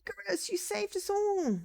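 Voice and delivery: very high-pitched villager's cry; grateful villager. Acted. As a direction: gush high in praise of the hero. Villagers hail the returning hero.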